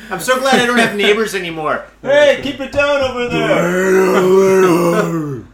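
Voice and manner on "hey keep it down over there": slow roaring scream-talk; Chewbacca roar voice. Pretend. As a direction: roar it like a Wookiee. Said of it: "almost Chewbacca now".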